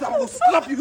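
high, loud furious threat; husband threatening his wife in a rage; angry threat. Acted. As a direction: scream the threat at her.

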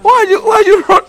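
high, loud call after her; startled call after a fleeing woman. Acted. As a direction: shout it as she runs off. He calls after her as she runs.

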